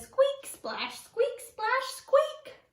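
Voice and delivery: very high little mouse voice; squeaking mouse. Acted. Mouse falling in the water, high-pitched squeaks. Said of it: "Mouse says".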